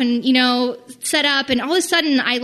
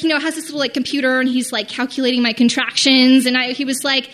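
normal talking voice

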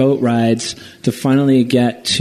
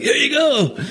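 very high, laughing mock voice of the guide; guide handing helmet. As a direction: squeak it out like a giddy local handing over his helmet. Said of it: "he's like".

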